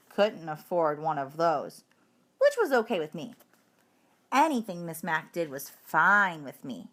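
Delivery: plain reading voice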